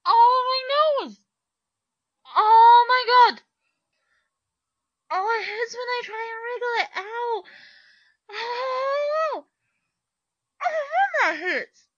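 high, loud cries of pain; pained yelling. Acted. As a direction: yelp through a sore nose. Hurt nose, crying out in pain.

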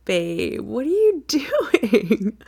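slow, high, laughing disbelief; amused surprise. Acted. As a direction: stretch it out through laughter. Surprised to find him.

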